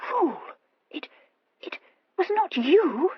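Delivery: very high, halting squeak. A narrator's reading. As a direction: squeak it out in disbelief. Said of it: "she squeaked".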